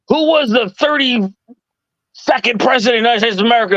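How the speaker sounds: lightly voiced imperious interrogation; haughty quizmaster. Imitating Backlund's quiz question.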